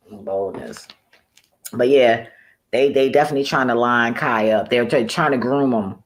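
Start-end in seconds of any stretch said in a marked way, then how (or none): 0.1-0.9 "Boldness" repeated much softer and lower, falling away; quiet echo. Real, not acted. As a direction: mutter "Boldness" again under your breath. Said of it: "The boldness".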